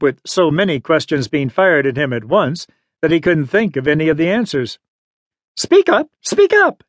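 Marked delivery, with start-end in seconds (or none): 5.6-6.8 high, sharp, impatient command; impatient old woman's high-pitched order; old lady snapping. A narrator's reading. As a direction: snap it high at a tongue-tied boy. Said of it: "the old lady snapped".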